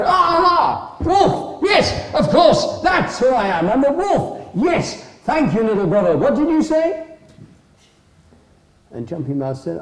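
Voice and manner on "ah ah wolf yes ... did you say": high, loud bursts of sudden recognition; forgetful wolf, excited. Acted. Vacant wolf remembers who he is, loudly overexcited. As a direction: startle awake and gabble with delight, high and loud.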